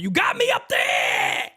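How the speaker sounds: very high, mock-yelling dad; exasperated father. Host mimicking his father's scolding, exasperated yell. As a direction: yell it like a fed-up father, straining upward. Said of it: "like my father used to tell me".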